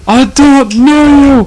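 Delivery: high, slow, frantic; panicked amnesiac. Acted. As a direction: act lost and panicking. Playing a confused amnesiac.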